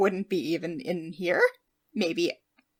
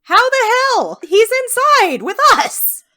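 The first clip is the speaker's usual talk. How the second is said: much higher and louder, incredulous; shocked outburst; 13 semitones higher, 9 dB louder than usual. Real, not acted. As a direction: blurt it out in shock. Player shocked at slaver.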